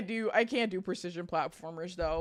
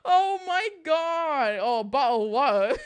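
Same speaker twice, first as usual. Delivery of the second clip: laughing; 9 semitones higher, 6 dB louder, slower than usual. Real, not acted. Cracking up after her accent.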